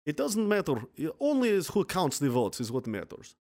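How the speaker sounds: put-on dictator voice; Stalin. Quoting a dictator on who counts the votes, put-on voice. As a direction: deliver it as an imitated foreign strongman. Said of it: "What did Stalin say?".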